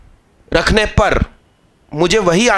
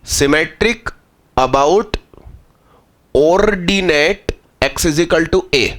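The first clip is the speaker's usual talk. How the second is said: slow dictation; 3 semitones lower, much slower than usual. Shown as a demonstration. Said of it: "इस प्रकार के function को कहेंगे".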